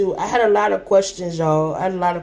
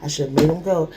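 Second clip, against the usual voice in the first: guilty murmur; 4 semitones lower, 3 dB quieter, faster than usual. Real, not acted. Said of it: "I just kept saying".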